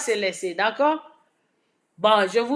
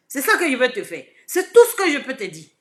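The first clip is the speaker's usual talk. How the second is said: ordinary talk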